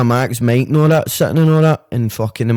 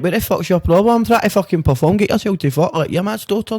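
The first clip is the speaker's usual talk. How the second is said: higher, heated mock rant; half-acted outrage, pitched up; retold angry telling-off; 7 semitones higher than usual. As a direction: re-enact snapping at the guy who crashed the shoot. Telling off the intruder.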